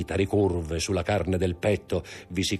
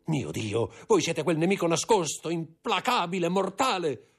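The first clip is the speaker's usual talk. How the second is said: terror climbing toward a scream; frantic dread; 12 semitones higher than usual. Acted. Villefort facing the hidden enemy, frantic high dread.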